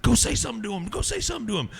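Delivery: high excited urging; friends egging on. Retold or imitated. Friends urging him to approach the singer. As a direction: push him to go talk. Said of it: "they were like".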